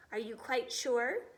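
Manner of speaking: usual speaking voice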